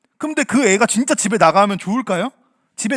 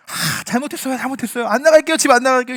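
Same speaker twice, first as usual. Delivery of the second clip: higher, hurried pleading apology; repentant child; 4 semitones higher, 4 dB louder than usual. Acted. Child begging parents not to throw them out, repentant. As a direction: plead like a scolded child, growing louder. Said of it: "이렇게 인정하고 뉘우치고".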